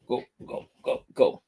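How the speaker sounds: hushed whisper to the dog; soft whispered urging; whispered shooing. Real, not acted. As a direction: whisper go go to shoo the puppy off.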